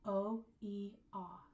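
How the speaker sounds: "O, E, ah" as separate held vowels; isolated vowel sounds. Shown as a demonstration. Teacher counting vowel sounds, isolated and distinct. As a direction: sound out "O, E, ah" one at a time. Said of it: "we have three vowel sounds".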